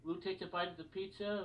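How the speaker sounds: ordinary talk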